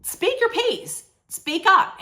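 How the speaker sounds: high mock pleading voice, a hint of Rula; urging wife. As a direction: pitch it up as the pushy wife urging him. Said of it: "Rula tells him".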